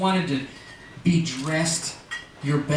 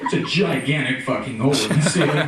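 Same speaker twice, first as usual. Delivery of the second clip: lower, laid-back airline captain; deadpan pilot over the intercom; pilot announcement voice; 3 semitones lower than usual. Acted. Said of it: "Why do pilots talk like that?".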